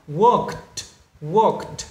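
Worked in one syllable, ending k-t. Shown as a demonstration. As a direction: tick a t onto Worked. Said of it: "E D lúc này được phát âm là". (đọc Worked với âm cuối t)

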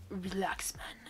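a soft hushed brush-off; low and quiet. Acted. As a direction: mumble it like it's nothing. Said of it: "he said lowly".

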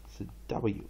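normal talking voice

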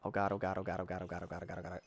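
low, soft frantic chant of oh God; panicked muttering. Real, not acted. Gamer hiding, muttering prayers under his breath.

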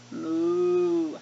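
long lowing moo; storybook cow moo. Acted. Cows mooing in picture book.

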